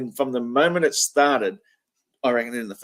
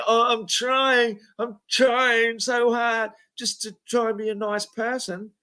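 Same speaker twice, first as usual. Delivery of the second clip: high, slow simpering voice; mocking whine; 9 semitones higher, slower than usual. Host mocking Craig's claim to be nice, high slow whine. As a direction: mimic him as a whiny goody-goody. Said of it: "now you're trying to say".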